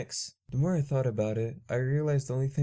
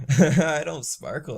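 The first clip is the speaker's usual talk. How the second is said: laughing; 4 semitones higher, 7 dB louder, slower than usual. Acted. Laughing denial.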